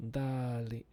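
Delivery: darling as a French person might; a try at French-accented darling. Shown as a demonstration. Testing how a French person says darling, French accent. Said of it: "How would a French person say it?".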